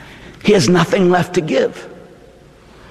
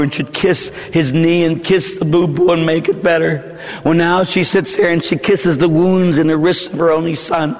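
normal talking voice

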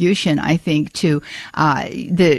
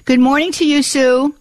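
chipper and happy; 7 semitones higher than usual. Real, not acted. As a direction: greet her with real cheer. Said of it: "You're sounding nice and chipper and happy today".

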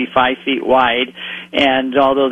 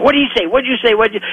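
mimicking yelling fishermen; 8 semitones higher, much faster than usual.

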